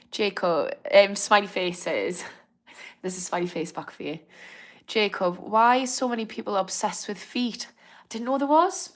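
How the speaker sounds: ordinary delivery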